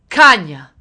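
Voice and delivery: loud, scornful curse; angry insult. A narrator's reading. Russian soldier kicking the mother away, loud angry insult. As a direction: hurl the insult.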